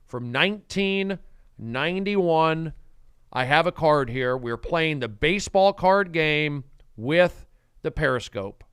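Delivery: usual speaking voice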